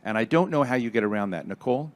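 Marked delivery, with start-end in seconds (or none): none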